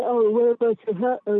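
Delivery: words stretched by a yawn. Real, not acted. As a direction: talk through a real yawn. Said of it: "excuse me with the yawn".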